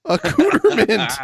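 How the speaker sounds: high, laughing "accoutrement" as "a-cooter-mint"; mispronounced accoutrement. Retold or imitated. Co-host repeats the botched word, "accoutrement" as laughing "cooter-mint". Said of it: "I like that pronunciation".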